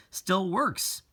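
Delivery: a hint of a kindly shop owner showing it works; shopkeeper's voice. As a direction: shopkeeper telling the boy it still works, lightly voiced. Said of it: "he signed".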